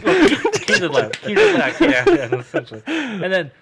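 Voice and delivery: high, slow through laughter; laughing. Real, not acted. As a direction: talk through giggles. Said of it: "laughing while telling".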